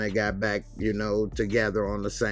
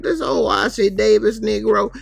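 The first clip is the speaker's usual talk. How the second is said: much higher and louder mock rant; loud insult; 12 semitones higher, 9 dB louder than usual. Kelly mocking the bus driver, loud and high. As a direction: hurl the insult at him.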